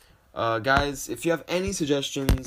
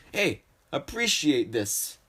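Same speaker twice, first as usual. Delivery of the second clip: mock scolding; 4 semitones higher, 4 dB louder, slower than usual. Acted slap-and-scold quote.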